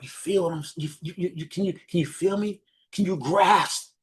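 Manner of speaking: halting, feeling-led questions; slow down and reach for the listener's feelings. Shown as a demonstration. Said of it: "you have to speak slower, more emotional".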